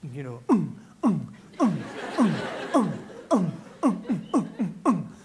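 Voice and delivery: bass thump mimicked in loud grunts; pounding club music done by mouth; mimicked bass beat. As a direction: thump out a car stereo beat with the voice. Imitating car stereo beat.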